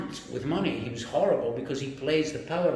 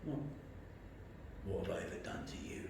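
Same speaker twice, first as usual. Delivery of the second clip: imitated boss, whispered and halting; very soft, with pauses, holding back; quiet menace; 5 semitones lower, 17 dB quieter, faster than usual. Boss confronting his employee, quietly menacing. Boss's quiet first question.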